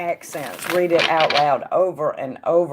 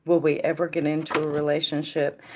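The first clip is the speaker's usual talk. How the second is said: normal talking voice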